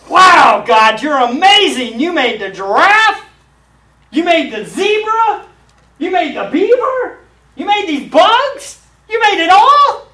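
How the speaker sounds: high, loud, thrilled praise; shouting in wonder at each animal; awed exclaiming. Retold or imitated. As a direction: marvel out loud like a child at the zoo. Believer marvels at creation in museum.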